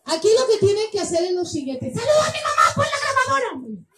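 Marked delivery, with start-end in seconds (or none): 1.9-3.8 high-pitched host shout; excited, high stage call; playful calling out. Real, not acted. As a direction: call out high across the crowd. Calling to players for the camera.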